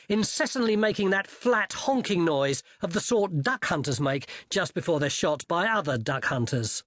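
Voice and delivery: plain reading voice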